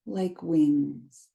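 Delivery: calm guided meditation read, unhurried pauses. Acted. Teacher guiding listeners through a grounding practice, meditation voice. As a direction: lead them gently into the body.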